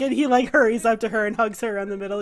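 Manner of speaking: ordinary talk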